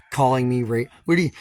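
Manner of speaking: ordinary talk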